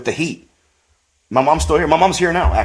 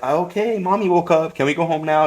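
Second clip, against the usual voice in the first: put-on higher, eager child asking to leave; little kid voice; 5 semitones higher than usual. Small son asking grandma to go home, higher childish voice. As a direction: ask like a hungry little boy.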